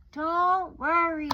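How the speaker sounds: mother calls it out to calm her; comforting mother. Acted. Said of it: "said her mother".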